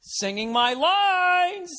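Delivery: very high, slow, camp Oh my God; flamboyant impression. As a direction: ham up the camp sitcom character. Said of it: "the other guy was just like".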